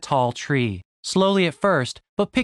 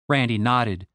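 ordinary delivery

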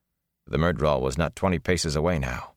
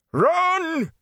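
much higher, louder scream; desperate shout; 3 dB louder than usual. A narrator's reading. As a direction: scream it with everything. Tom shouting while fighting.

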